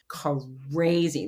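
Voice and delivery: CRAZY stretched out long; slow, exaggerated crazy; drawn-out emphasis. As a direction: draw out crazy, lingering on the first syllable. Stretches the word while describing losing control.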